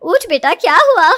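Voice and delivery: loud, urgent motherly waking call; worried mother. Acted. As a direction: rouse a sleeping daughter with loud concern. Mother waking her frightened daughter.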